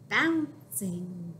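usual speaking voice

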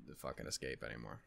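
dropping low and soft, trailing away; trailing off quietly. Real, not acted. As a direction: let it fade to almost nothing. Fearing sadness taking over.

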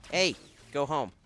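high mocking jeer; taunting. Real, not acted. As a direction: taunt the loser. Taunting an enemy in game.

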